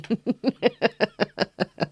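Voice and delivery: soft, long self-amused chuckle. Real, not acted. Podcaster laughing at own hypocrisy, chuckling. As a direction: chuckle quietly to yourself. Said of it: "I'm just chuckling at myself".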